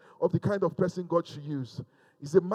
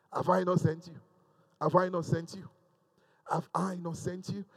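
soft insistence; 3 semitones lower, 7 dB quieter than usual. Acted. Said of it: "that's the word for someone here".

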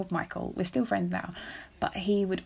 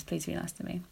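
quietly sincere, much softer; mean it, say it softly; a soft, earnest request to listeners; sincere plea; 7 dB quieter, faster than usual. Real, not acted. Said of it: "No, I'm serious".

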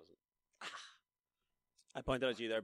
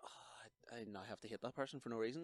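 hushed, faster, half laughing; whispered dismay; 8 dB quieter than usual. Retold or imitated. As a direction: breathe it out in dismay. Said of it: "or are yous like".

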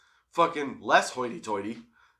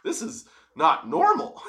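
higher, slow mock alarm; startled whoa; 8 semitones higher than usual. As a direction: pull back in surprised disbelief. Retelling his startled reaction.

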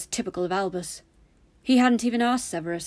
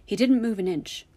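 ordinary delivery, nothing marked

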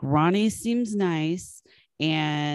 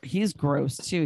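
normal talking voice

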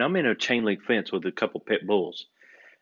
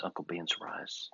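soft unvoiced joking aside; whispered aside; 5 semitones lower, 14 dB quieter than usual. Real, not acted. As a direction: slip the punchline in a whisper. Aside joke about the name.

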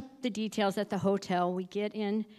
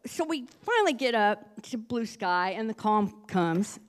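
ordinary talk, nothing marked

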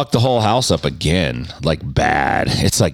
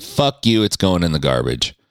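ordinary delivery

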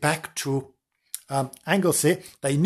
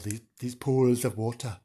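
usual speaking voice

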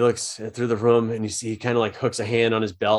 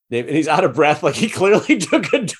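laughing; 6 semitones higher, 5 dB louder than usual. Real, not acted. DM laughing while narrating.

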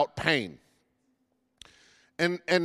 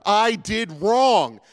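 higher-pitched, drawn-out indignant protest; really angry; 6 semitones higher, slower than usual. Acted. Job challenging God over his suffering, acted anger. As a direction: protest your innocence in raised, stretched-out words. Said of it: "Job gets really angry".

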